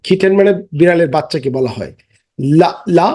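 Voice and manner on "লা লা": ordinary talk, nothing marked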